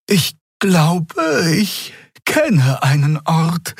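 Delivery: the pilot tentatively suggesting a refuge; hesitant pilot. A narrator's reading. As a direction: offer it slowly, unsure. Xea speaks up.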